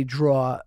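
"draw" for drawer, no final r; dropped-r pronunciation. Retold or imitated. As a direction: say "draw" with an open vowel and no r. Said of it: "'cause I said draw".

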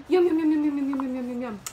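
rapid yums falling in pitch; nibbling run that fades away; munching mouse. Acted. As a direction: gobble it up.

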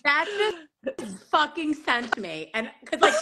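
ordinary talk

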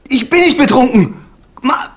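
loud, high, frantic yell; desperate shout. Acted. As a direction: scream it down the phone. Yelling into phone at Martha.

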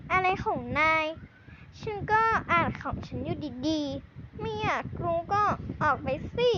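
ordinary talk, nothing marked

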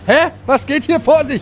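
loud, high, baffled; surprised shout. Real, not acted. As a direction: shout in disbelief. Confused shout at game. (verblüfft und laut)

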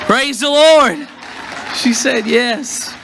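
high, drawn-out joyful exclamation; jubilant. Real, not acted. Celebrating a proposal before the congregation.

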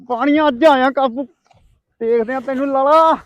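gloating taunt. Acted. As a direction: rub it in. Gloating at Lala. (ਮਜ਼ਾਕ ਉਡਾਉਂਦਾ ਤਾਅਨਾ)

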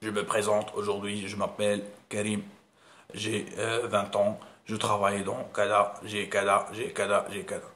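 low, slow, droning voice; listless monotone presenter; dull mumbling speaker. Shown as a demonstration. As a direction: drone on like a bored speaker. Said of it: "غير ايماجيني واحد غيهضر معاك بحال هكا".

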